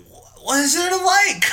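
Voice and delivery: loud, high, drawn-out disbelief; incredulous outburst. Real, not acted. Podcaster challenging co-host who liked the actor, loudly incredulous. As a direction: cry it out slowly in exasperation. Exclaims in argument over the actor.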